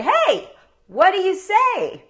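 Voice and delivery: high, slow coaxing reminder; a mother nudging for manners; prompting mom. Retold or imitated. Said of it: "that's when I say".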